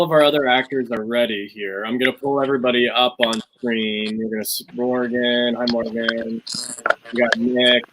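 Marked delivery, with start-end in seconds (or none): none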